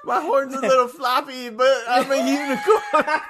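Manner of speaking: laughing mock unicorn. Co-host joins unicorn bit, laughing hammed-up voice. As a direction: proudly insist through giggles. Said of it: "running through there being like".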